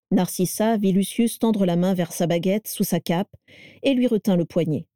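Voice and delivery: plain reading voice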